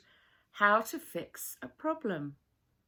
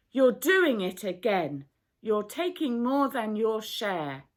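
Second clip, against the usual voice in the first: accusing; 2 semitones higher, 9 dB louder than usual. A narrator's reading. Quarrel over food shares.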